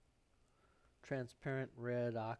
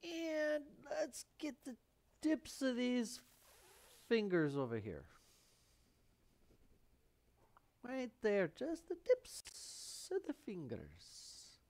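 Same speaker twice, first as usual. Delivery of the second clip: pitched up, slow and careful; high focused voice; 10 semitones higher, slower than usual. Real, not acted. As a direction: go up high and slow while dabbing.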